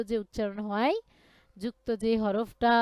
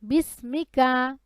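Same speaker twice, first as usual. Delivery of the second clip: recitation for repeating; 5 dB louder than usual. Shown as a demonstration. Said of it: "বলেন".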